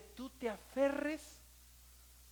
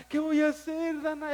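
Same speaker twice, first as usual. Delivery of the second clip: loud, fast, despairing cry; retold loud plea to Dana; anguished plea to wife; 5 semitones higher, 10 dB louder than usual. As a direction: beg your wife in despair. Retells desperate plea to wife.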